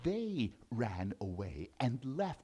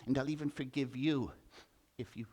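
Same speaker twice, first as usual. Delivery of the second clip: gentle mouse; 4 semitones lower, 6 dB quieter than usual. Acted.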